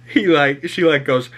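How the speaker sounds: usual speaking voice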